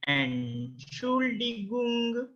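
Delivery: Entschuldigung in long, separated, high syllables; slow pronunciation model. Shown as a demonstration. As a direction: stretch Entschuldigung out syllable by syllable, "en-shool-dee-goong". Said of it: "try to pronounce it".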